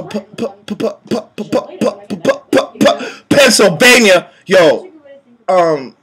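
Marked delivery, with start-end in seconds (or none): 0.0-4.3 mock stutter. Stuttering comically on Pennsylvania.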